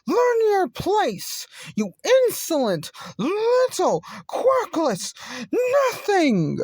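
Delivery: high, drawn-out furious shout. Acted. Bully telling Deku to learn his place, furious high shout. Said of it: "Shouted the".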